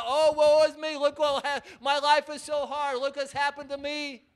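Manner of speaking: high, loud mock wail; mock pity party. Preacher mocking Esau's pity party, loud mock wail. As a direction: ham up the woe-is-me lament. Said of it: "have a big pity party".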